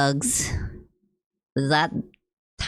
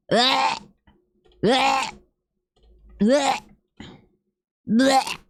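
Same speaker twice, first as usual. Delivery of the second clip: gagging blech sounds; dry heaving; 9 semitones higher, much slower than usual. Real, not acted. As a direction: retch it out again and again. Repeated gagging at fear.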